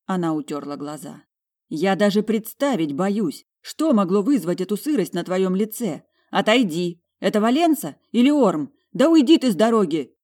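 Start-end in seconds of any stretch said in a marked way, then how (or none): 1.7-10.1 loud irritation building to a shout; exasperated. A narrator's reading. Demanding her sister move aside, exasperated. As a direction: lose patience, ending on a forceful order. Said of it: "Моё раздражение вышло на новый уровень".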